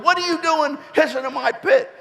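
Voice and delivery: high mock old-lady scold; imitating Missus Pot. [name] mimicking Missus Pot to his mother, high mock scold. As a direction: mimic the neighbour's squeaky complaint.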